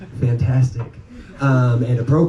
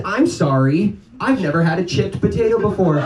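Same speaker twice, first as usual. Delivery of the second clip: high-pitched, indignant imitation; mock pissed off; 7 semitones higher than usual. Mom snaps at dad over potato chips, mock pissed off. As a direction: play up the offended, sarcastic demand. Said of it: "immediately gets pissed and says".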